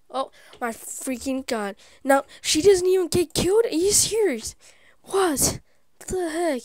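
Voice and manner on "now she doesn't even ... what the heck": high, loud indignant rant; outraged disbelief. Real, not acted. Player protesting that her rival never gets killed, outraged. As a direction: yell it like you've been cheated.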